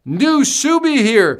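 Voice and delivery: high, loud and slow, stretched out with delight; excited welcome. Acted. Host greets a new subscriber in the chat, high and drawn-out.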